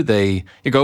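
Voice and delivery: normal talking voice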